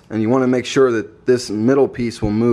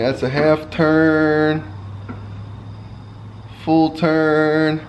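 strained, counting turns under car; muffled, working under the car; 5 semitones higher, much slower than usual. Real, not acted. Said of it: "I'm under the car right now".

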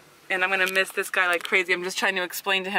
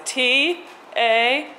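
letters spaced slowly; spelling it out; 4 semitones higher, 4 dB louder, much slower than usual. Shown as a demonstration. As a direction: say each letter slowly and apart. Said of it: "He just spelled it".

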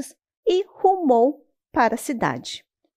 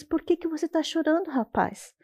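softer, caring turtle; gentle question; 6 semitones higher, 5 dB quieter than usual. Acted. Turtle gently asking the boy why he is crying. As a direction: ask softly, with concern.

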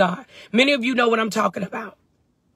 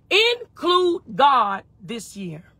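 ordinary delivery

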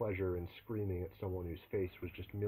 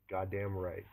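ordinary delivery, nothing marked

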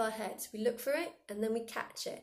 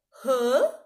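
much louder, baffled huh; confused huh; 3 semitones higher, 13 dB louder than usual. Shown as a demonstration. Teacher demos a weird confused noise. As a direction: blurt a bewildered huh. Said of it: "make the, the weirdest noise you can".

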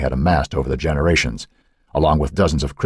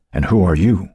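ordinary delivery, nothing marked

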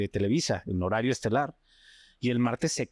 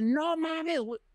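panicked; slower than usual. Retold or imitated. Crew panicking in quake.

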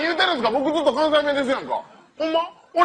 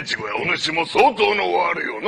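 悪代官の台詞; 7 semitones lower than usual. Acted.

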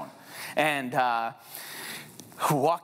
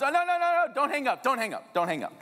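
pleading panic; 9 semitones higher than usual. Retold or imitated. Begging Tyler not to hang up.

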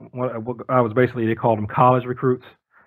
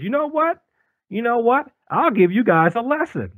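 high challenge; 10 semitones higher than usual. Retold or imitated. Min challenging them.

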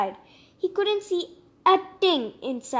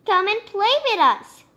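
friendly invite; 5 semitones higher, 5 dB louder than usual. Acted. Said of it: "they would call".

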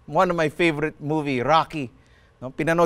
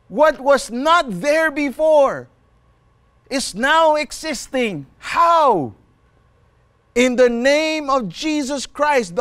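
high-pitched, fervent preaching; excited preaching; 5 semitones higher, 3 dB louder, slower than usual. Real, not acted. Preacher telling of the lame man healed, excited preaching. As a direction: proclaim the miracle with rising excitement.

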